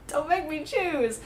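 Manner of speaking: laughing plea. Real, not acted.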